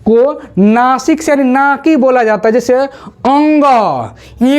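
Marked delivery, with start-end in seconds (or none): 2.7-3.6 अंग said slowly with the nasal ng ringing; nasal sound demo. Shown as a demonstration. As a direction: stretch अंग out through the nose. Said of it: "थोड़ा नकिया करके बोलेंगे". (अंग को नाक से धीरे बोलें)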